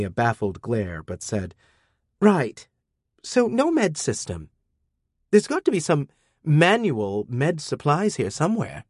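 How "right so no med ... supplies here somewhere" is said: put-on high, brisk girlish voice; teen girl deciding on manual med supplies; young girl voice. A narrator's reading. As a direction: Amina pitched up, getting practical. Amina voiced by narrator.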